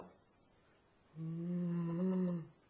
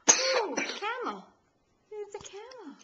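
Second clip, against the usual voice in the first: high, loud, stretched out oh camel; slow, drawn-out delighted gasp; delighted surprise; 11 semitones higher, 6 dB louder, slower than usual. Pretend. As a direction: act amazed for the little one. Delighted reveal to baby.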